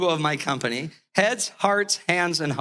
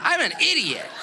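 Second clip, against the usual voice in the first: laughing self-mockery; 8 semitones higher than usual. Real, not acted.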